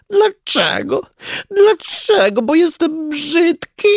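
very high, sobbing lament; loud wailing. A narrator's reading. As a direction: cry it out as a miserable little creature. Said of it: "głośno zawodziło". (płaczliwe, głośne zawodzenie)

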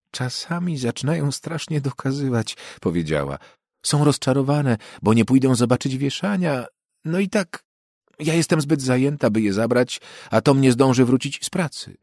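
a hint of a tired mother making excuses; harried mother. Mrs Parsons explains. (pani Parsons tłumaczy się gościowi, lekko zdenerwowana)

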